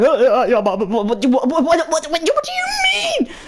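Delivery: panicked stammer rising to a high squeal; hammed-up shocked babbling; mock heart attack. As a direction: splutter wildly, then shriek the last words. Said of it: "having a heart attack".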